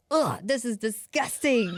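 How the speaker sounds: slow, drawn-out disgust; disgusted whine. Retold or imitated. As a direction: groan it out in disgust. Child tasting honey, disgusted.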